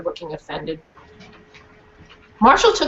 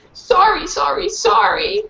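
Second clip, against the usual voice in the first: high, slow whiny apology; dog whining; 10 semitones higher, slower than usual. Acted. Guilty dog whining sorry to the crying girl, high and slow. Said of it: "I whined".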